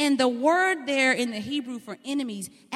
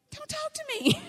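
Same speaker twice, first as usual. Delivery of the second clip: much higher, pouting brush-off; sulky child; 3 dB quieter than usual. Retold or imitated. Spanked son snubbing his cheerful father, high pouting voice. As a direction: pout it like a hurt kid.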